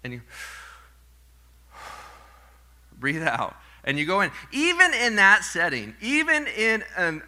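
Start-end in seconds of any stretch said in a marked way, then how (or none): 3.0-3.6 demo exhale. Shown as a demonstration. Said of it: "Okay, breathe in".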